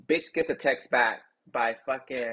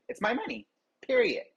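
high, emphatic insistence; raised, firm declaration; defiant; 11 semitones higher than usual. Retold or imitated. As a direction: hit it hard and final. Defiant retold rant.

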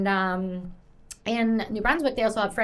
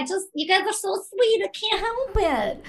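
real tearful, high-pitched squeak; choked up; 10 semitones higher than usual. Real, not acted. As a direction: voice climbing high as emotion takes over. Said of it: "Getting all choked up".

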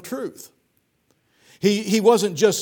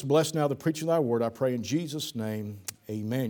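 softer, low prayer voice, bowed and sincere; quiet earnest prayer; 5 semitones lower, 4 dB quieter than usual. Acted. Pastor opening the service in prayer, soft earnest prayer. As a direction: pray over the service gently. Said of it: "Let's pray".

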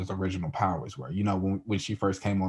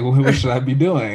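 higher, louder mock innocence; mock clueless Vision; 5 semitones higher, 9 dB louder than usual. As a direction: play Vision puzzled and eager. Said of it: "He's like".

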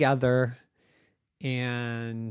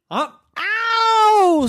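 very high, loud ow; comic pain yelp; 8 dB louder than usual. Acted. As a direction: squeal it like a bitten cartoon dragon. Dragon bites porcupine.

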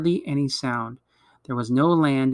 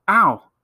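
sudden loud, higher-pitched "ow"; cry of pain; 5 semitones higher, 7 dB louder than usual. A narrator's reading. Boy breaks off mid-sentence with a pained yelp. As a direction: yelp out as if stung. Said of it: "made a noise like".

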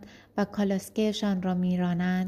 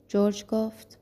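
ordinary delivery, nothing marked

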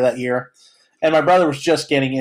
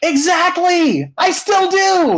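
excited; 13 semitones higher, much slower than usual. Real, not acted. Excited agreement about Ducks.

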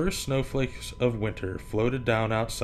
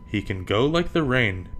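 plain reading voice

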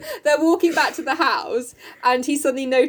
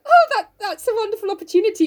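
Jo; 8 semitones higher than usual. Acted. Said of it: "And Joe's like".

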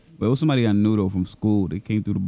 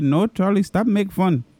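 much higher, exasperated Indian boss; mock Indian accent; 9 semitones higher, slower than usual. Boss ordering the clerk to stop, mock Indian accent. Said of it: "I was mimicking my boss at seven eleven".

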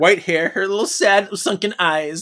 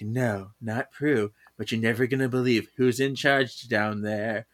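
hint at the secret slyly; lightly mysterious; 5 semitones lower, 6 dB quieter than usual. Acted.

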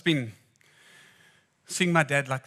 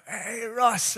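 a loud, much higher, joyful hello; happy greeting; 8 semitones higher, 4 dB louder than usual. Retold or imitated. Round cook runs out to greet Ross with a joyful call. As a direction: call out with delight.

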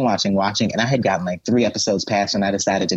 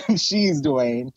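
ordinary talk

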